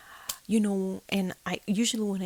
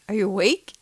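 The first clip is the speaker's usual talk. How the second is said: louder, urgent asking; urgent question; 7 dB louder than usual. Retold or imitated. To husband in the dark, loud. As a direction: call to him sharply. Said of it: "I go".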